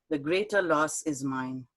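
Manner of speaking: usual speaking voice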